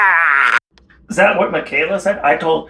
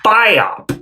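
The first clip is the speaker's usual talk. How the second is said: usual speaking voice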